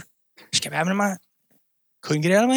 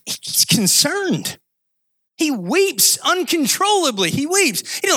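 high, drawn-out sermon emphasis; emphatic preaching; 9 semitones higher, slower than usual. Real, not acted. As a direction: stretch each key word with fervent conviction.

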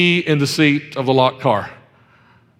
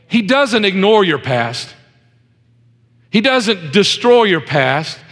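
slow, deliberate pulpit declaration; measured, weighty sermon delivery; emphatic preaching; 5 semitones higher, slower than usual. Real, not acted. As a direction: preach each denial slowly and firmly. Preaches the moral.

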